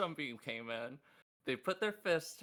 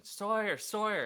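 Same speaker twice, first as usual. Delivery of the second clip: retold screaming; 7 semitones higher, 5 dB louder than usual. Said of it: "she started screaming".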